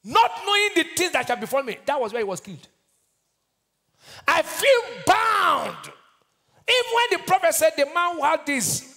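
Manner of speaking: high, loud scripture declamation; full-force preaching. Acted. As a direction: preach it out, stretching key words into a shout.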